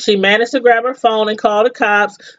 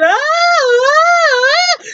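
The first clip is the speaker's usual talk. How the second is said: imitating her screams; much slower than usual. Recapping the show, mimics the victim's screams, high and mock-pained. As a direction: copy the woman screaming on the floor, pitched way up. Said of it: "she's just down there screaming".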